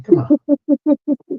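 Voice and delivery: high, loud teasing protest; playful disbelief; teasing high come on. Real, not acted.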